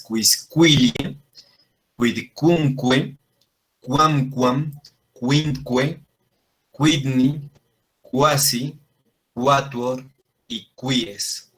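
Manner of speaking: word-by-word drill from quisquilie to quies, every qu as kw. Shown as a demonstration. Teacher leads the class exercise, each word from quisquilie to quies with careful kw. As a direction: sound the u after q in quisquilie, quamquam, quinque, pausing between words. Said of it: "hagan el ejercicio ahorita".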